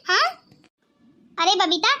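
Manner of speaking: ordinary talk, nothing marked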